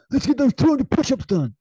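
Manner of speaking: high, loud hammed-up command; mock Goggins order. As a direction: order it like a drill sergeant. Goggins ordering push-ups.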